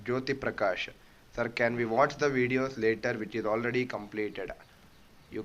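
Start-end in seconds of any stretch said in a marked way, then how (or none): none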